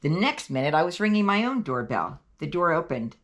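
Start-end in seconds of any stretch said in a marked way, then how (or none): none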